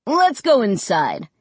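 mild, inviting push; urging. A narrator's reading. Realtor to the family at the door, mildly urging. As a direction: gently urge them along. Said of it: "Mister Dawes urged".

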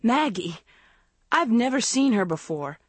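earnest cry to be believed. A narrator's reading. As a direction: protest it desperately. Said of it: "Andrea cried".

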